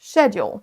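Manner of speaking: SCHEDULE said SHED-yool; accent britannique. Shown as a demonstration. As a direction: open SCHEDULE on a British SH. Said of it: "on a l'accent U K". (SCHEDULE à la britannique, avec CH)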